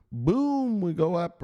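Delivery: sudden high burst of Boom; excited boom. Real, not acted. Explaining the chart to viewers, an excited Boom.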